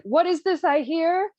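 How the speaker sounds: playing the jealous count bursting out; mock angry count. Count bursting from hiding, mock indignant. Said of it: "he's like".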